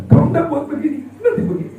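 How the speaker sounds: high, exaggerated threatening voice; hammed-up warning; mock threat. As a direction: sound like a nagging scold. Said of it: "Akhirnya kita ancam".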